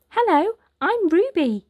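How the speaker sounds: put-on bright little girl introducing herself. Girl greeting Oliver with a smile in a little girl voice. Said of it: "smiling at Oliver".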